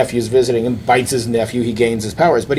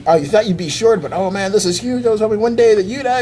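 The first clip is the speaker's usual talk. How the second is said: put-on excited kid; 8 semitones higher than usual. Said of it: "It's like".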